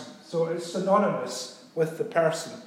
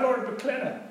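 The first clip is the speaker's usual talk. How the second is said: deferential; 3 semitones higher than usual. Shown as a demonstration. Preacher meeting Lord MacLennan, high and deferential. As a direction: greet a noble with deference. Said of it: "deferential like that".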